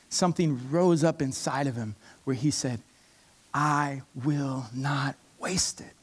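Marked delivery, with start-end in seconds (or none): 3.5-5.9 slow, breathless whisper of resolve; a hushed, determined vow, slowed right down. Acted. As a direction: whisper it like a private oath.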